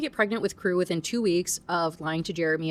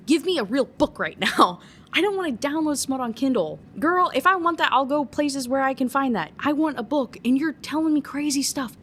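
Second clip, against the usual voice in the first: rising, louder exasperated rant at the author; mock rant; 6 semitones higher, 3 dB louder than usual. Ranting at author in quote.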